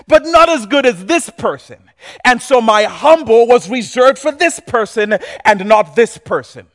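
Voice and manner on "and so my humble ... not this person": normal talking voice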